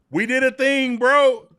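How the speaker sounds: loud, high hype announcement; excited hype. Real, not acted. Co-host teased about the signing, loud excited hype. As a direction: hype up the big news to Brad.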